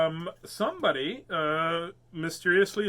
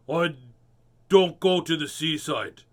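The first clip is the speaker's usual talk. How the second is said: cross; 7 dB louder than usual. Acted. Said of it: "said Mister Fat crossly".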